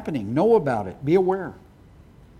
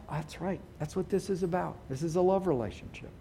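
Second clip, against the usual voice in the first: quiet aside; 10 dB quieter than usual. Real, not acted. Softer realization.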